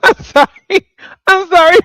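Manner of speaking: high, loud apology through laughter; sorry said while cracking up; laughing apology. Real, not acted.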